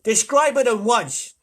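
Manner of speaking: slow, loud, high command; ground control's imperious order; barked order. A narrator's reading. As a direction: order it firmly, drawn out. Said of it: "ordered ground control in Houston".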